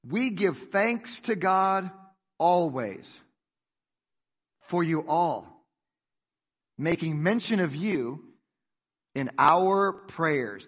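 slow, deliberate reading with long pauses between phrases; slow scripture reading. Shown as a demonstration. Preacher reading scripture aloud to the church, slow and weighty. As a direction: read the verse aloud to the congregation, slowly and deliberately. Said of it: "Look, it says in verse two".

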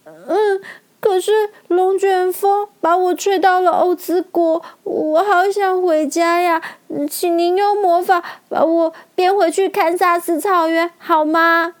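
音调高高的小女孩嗓音; 小女孩怯生生地恳求大王; 恳求的小女孩. A narrator's reading. (用小女孩的高嗓音恳求)

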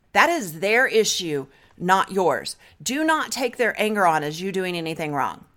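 ordinary talk, nothing marked

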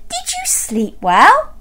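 high, rising query; cheery Missus Bear. Acted. Missus Bear asking her husband on waking, high cheery question. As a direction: ask it high and bright-eyed. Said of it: "she said".

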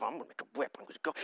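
very quiet, low mumbled mutter; muttering to himself. Acted. Jesus sitting on the ground plotting a whip, muttering to himself. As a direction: mutter it under your breath as if absorbed. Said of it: "muttering to himself".

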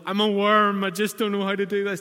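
hammed-up voice of the guilty inner critic; self-pitying wail. Voicing self-condemning thoughts.

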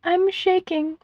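high, tearful mother's voice; grieving mother, pitched high; sniffling grief. A narrator's reading. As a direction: choke up as you speak, breaking off before the hardest words. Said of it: "Her mama sniffed".

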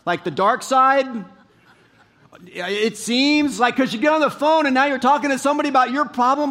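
rally the crowd with a shared gripe; preaching at full force. Acted.

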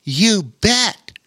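can't hold back the yes; blurted. Acted. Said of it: "he blurted out".

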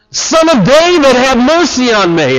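high, forceful cry for mercy; imitated primal scream. Preacher voices blind beggar's ungovernable cry, shouted plea. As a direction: hurl out a desperate plea, starting high. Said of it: "described as a primal scream".

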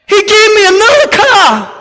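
high, loud, near tears with joy; ecstatic shout. Real, not acted. Preacher to congregation, shouting with joyful disbelief. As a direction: cry it out at the top of the voice. Excited climax of story.

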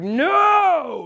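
sudden high, loud shout. Retold or imitated. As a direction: hurl a defiant no at the guards. Peter lunging to defend Jesus.

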